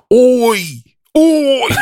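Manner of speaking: high, loud, shouted "Oi, oi" as a London lad; mock London "oi". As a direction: bark "Oi, oi" like a dumb London bloke. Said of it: "London dumb is".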